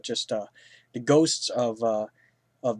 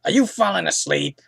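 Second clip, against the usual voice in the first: higher, louder mock friend's voice; imitating Brian; 6 semitones higher, 8 dB louder than usual. Friend Brian catching the reviewer dozing, mock voice. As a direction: poke at him in a put-on voice. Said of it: "Brian even said".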